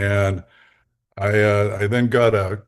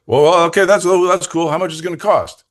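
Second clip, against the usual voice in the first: eager client; 7 semitones higher, 4 dB louder than usual. Retold or imitated. Clients quoted asking the cost.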